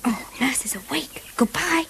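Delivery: whispered aside, hushed; a secretive whispered goodbye. Acted. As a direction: hush it so Nurse won't hear. Child hanging up secretly.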